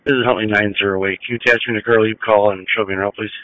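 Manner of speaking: quick call over a two-way radio; rushed radio transmission; police radio. Real, not acted. Unit repeats over radio.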